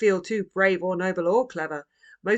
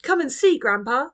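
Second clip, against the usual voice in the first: put-on much higher and louder, bouncy girl; an excited girl who can't keep still; impatient child; 8 semitones higher, 8 dB louder than usual. A narrator's reading. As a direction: Roo hopping impatiently, eager and loud. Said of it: "hopping impatiently".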